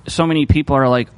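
ordinary talk, nothing marked

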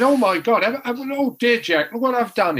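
high-pitched imitation of a flustered doctor; mock dismay. Doc realises his stitching blunder, high mock dismay. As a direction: exclaim as if you've just botched it.

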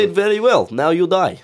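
high, loud mock Russian threat; menacing Russian villain. Host voicing Putin in the bathroom as a hammed-up Russian villain. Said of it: "Putin's just standing there".

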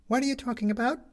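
put-on high, puzzled young boy; boy asking, voiced high. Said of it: "said the boy".

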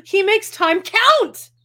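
slow, high, loud declaration; mock proclamation. Reader mocking Robin praising her husband, loud proclamation. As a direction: belt out each word with exaggerated emphasis. Reading book passage with mock emphasis.

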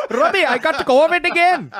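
put-on high, loud Indian accent; Usama Siddiqui impression. Host imitating comic friend, loud Indian accent. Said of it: "great Usama Siddiqui impression".

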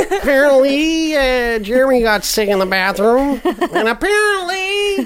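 put-on high, laughing kid voice; Apparently kid impression. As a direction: giggle through it like the Apparently kid. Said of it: "sounds like the Apparently kid".

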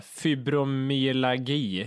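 very slow, syllable by syllable; careful pronunciation. Real, not acted. Podcaster unsure he says the illness right, slow and careful. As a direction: stretch out a difficult word, feeling your way through it. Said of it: "om jag säger rätt nu".

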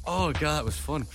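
ordinary delivery

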